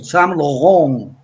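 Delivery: very slow Saint Laurent, each syllable drawn out the French way; French pronunciation. Shown as a demonstration. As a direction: spell the sound out for the class. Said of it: "francés". (Saint Laurent en francés, muy despacio)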